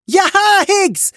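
high, loud yell of encouragement; roaring cheer. A narrator's reading. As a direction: bellow it out high to a fighting friend. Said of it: "brüllte Fischbein".

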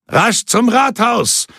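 high, drawn-out rallying call; leader's cry, pitched far above normal. A narrator's reading. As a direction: call the order out to the troop. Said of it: "rief César".

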